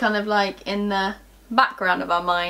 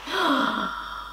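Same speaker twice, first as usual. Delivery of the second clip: sharp, higher gasp of realisation; mock gasp of shock; acted shocked gasp; 5 semitones higher, 5 dB quieter than usual. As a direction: gasp as if it just dawned on you. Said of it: "And we were like".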